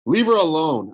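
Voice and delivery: Percy telling Luke off, loud; firm shout. A narrator's reading. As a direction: step in hard for her. Percy defends Annabeth.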